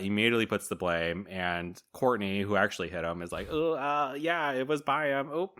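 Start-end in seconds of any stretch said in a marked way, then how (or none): 3.5-5.5 high, sheepish fib with a nervous drawl; sheepish fake innocence. Courtney shifting the blame in a high put-on voice. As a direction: play dumb and pin it on him.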